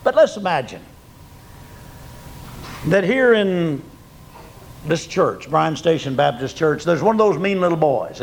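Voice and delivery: ordinary talk, nothing marked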